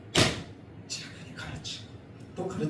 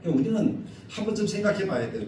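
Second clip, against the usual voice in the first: ordinary talk, nothing marked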